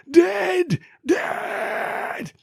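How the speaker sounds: very high crying shriek; mock scream. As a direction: scream it like a B-movie victim. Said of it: "scream overload".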